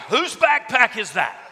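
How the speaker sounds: high, booming demand; outraged mock shout. Speaker hunting the owner of the ringing backpack, outraged mock shout. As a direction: bellow it in mock outrage at the whole room.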